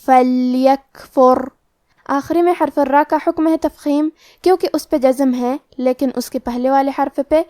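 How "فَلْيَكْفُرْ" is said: فَلْيَكْفُرْ recited slowly, heavy full-mouthed را at the end; tajweed recitation. Shown as a demonstration. Teacher demonstrating the word فَلْيَكْفُرْ, slow recitation. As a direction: chant فَلْيَكْفُرْ with a thick رْ. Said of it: "تو ہم یہ کلمہ کو ایسے پڑھیں گے".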